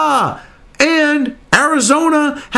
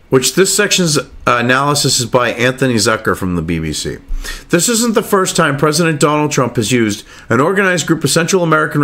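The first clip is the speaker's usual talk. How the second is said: lower, measured reading-aloud voice; news article read; 9 semitones lower than usual. Acted. Reading the BBC piece aloud to viewers, measured read.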